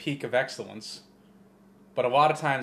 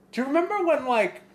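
mock amazed; 7 semitones higher than usual. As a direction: play yourself gawking at the screen. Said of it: "And I'm like".

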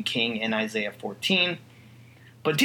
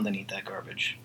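whispered; 7 semitones lower, 10 dB quieter than usual. Real, not acted. Crude aside, unvoiced.

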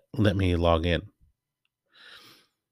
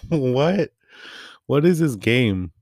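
higher, slower, laughing disbelief; can't believe what you just saw; amused disbelief; 6 semitones higher, slower than usual. Retold or imitated. Reacting to a crude game clip in higher, slower, amused disbelief.

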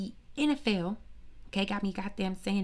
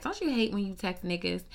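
ordinary talk, nothing marked

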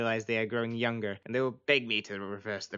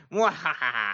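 loud, high mwahaha; evil villain laugh; 5 semitones higher, 5 dB louder, slower than usual. Acted. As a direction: cackle like a cartoon villain. Villain laughs after plan.

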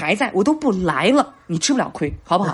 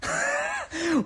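high squeaky laugh; 7 dB quieter than usual. Real, not acted. Laughing at own regret.

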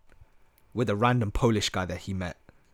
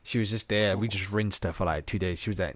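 normal talking voice

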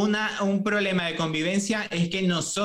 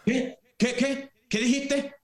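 higher, startled repeated what; imitating a startled father, pitched up; mock disbelief from the father; shocked father; 5 semitones higher, slower than usual. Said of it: "y su padre".

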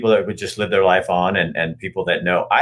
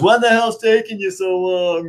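put-on drunk guy; 12 semitones higher than usual. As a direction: play the impatient drunk at the urinal. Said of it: "and he's drunk".

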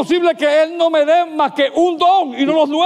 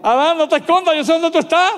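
raised-pitch mock confrontation; confronting, acted; 5 semitones higher, faster than usual. Preacher as God catching Adam in the garden, confronting. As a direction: call out someone caught hiding. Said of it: "si yo hubiese sido Dios".

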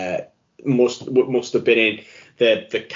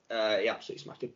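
quick, much softer echo; quiet aside; 11 dB quieter, faster than usual. Shown as a demonstration. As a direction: repeat it under your breath, quickly. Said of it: "quiet repeat".

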